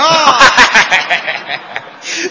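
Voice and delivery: real laugh. Real, not acted. Partner laughs at the joke.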